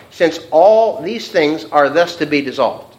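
slow verse reading, "all" hit hard and loud; stress on all. Shown as a demonstration. Teacher rereading the underlined verse, stress on "all". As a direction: read slowly, landing heavily on "all". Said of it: "Underline that word".

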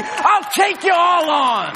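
high, loud, slow and triumphant; bold shout. Acted. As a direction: declare it fearless and full-throated. Spirit-bold take vs drunk.

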